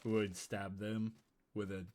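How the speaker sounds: usual speaking voice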